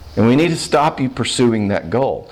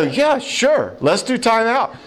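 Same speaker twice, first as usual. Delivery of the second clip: much higher, overeager "yeah, sure"; eager mock husband; 10 semitones higher, slower than usual. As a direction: sell it like a husband who can't wait to leave. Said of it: "He'd go".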